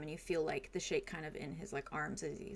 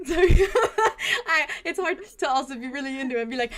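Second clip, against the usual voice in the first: much higher, louder giggling apology; breaking up laughing; 11 semitones higher, 19 dB louder, faster than usual. Real, not acted. Player laughing out of character with the table. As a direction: laugh through the words, can't keep it together. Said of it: "We're being really hard on you today".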